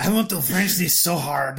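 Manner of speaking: put-on French accent. Joking French banter over whiskey.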